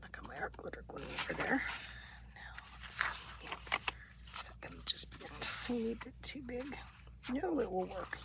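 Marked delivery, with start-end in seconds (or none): 0.0-6.8 soft ASMR whisper; breathy whisper while crafting. Real, not acted.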